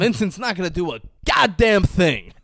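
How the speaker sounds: commanding reassurance. Retold or imitated. Jules reassuring her.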